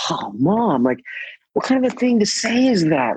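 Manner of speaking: jumps high on the last line, incredulous at his mom; a son protesting, half laughing, half appalled; exasperated protest. Retold or imitated. Son retorting to his mom's gloomy remark, high and incredulous.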